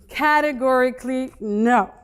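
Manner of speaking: loud, flat-out no; firm loud refusal. Retold or imitated. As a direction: refuse it outright, forcefully.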